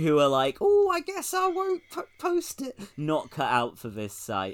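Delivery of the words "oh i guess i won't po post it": very high, squeaky mocking voice; mock timid whine. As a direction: squeal it as a feeble would-be poster. Mocking timid would-be posters.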